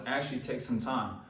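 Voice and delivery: ordinary talk, nothing marked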